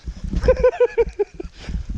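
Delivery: high, wheezy laughter; long high giggle fit; helpless giggling. Real, not acted. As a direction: laugh till the voice gives out. Laughs while hopping about.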